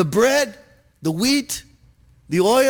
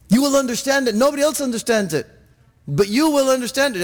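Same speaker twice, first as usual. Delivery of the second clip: ordinary delivery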